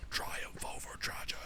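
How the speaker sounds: breathy stage whisper of a tagline; hushed dramatic whisper. Acted. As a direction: whisper it like a movie trailer line. Dramatic line about the player.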